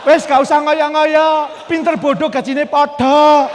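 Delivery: loud, slow, scoffing drawl; cynical Javanese coworker. Retold or imitated. Jaded colleague scoffing at his friend, slow and loud. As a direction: scoff to kill his enthusiasm.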